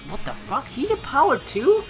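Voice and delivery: ordinary talk, nothing marked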